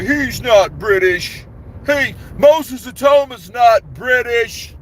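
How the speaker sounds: high, loud, slowed-down mock of the naysayers; mocking the doubters. Streamer mimicking fans who deny Moses Atoma is British, high and loud mockery. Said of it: "a lot of people are like".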